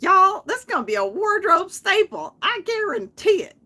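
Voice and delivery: loud, high, hammed-up American accent; big, high-pitched American sales pitch. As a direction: exaggerate an American "y'all" accent, belting it out. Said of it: "that American accent. She'd be like".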